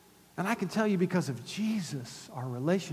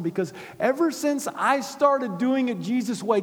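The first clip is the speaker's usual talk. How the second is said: ordinary talk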